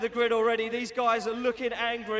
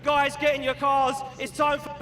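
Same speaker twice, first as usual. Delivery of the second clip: ordinary delivery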